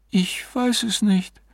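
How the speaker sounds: slow, high woman's voice; bewildered wife. A narrator's reading. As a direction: answer slowly and high, at a loss. Wife bewildered after spirits leave.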